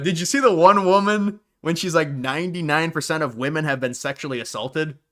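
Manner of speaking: normal talking voice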